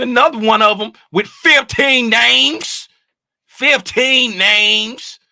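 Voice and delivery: high, loud exclamation with stretched words; loud amazement. Real, not acted. Host marvels at a viewer's fifteen names, loud amazement. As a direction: exclaim it in real disbelief, drawing the words out.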